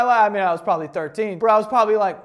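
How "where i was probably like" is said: normal talking voice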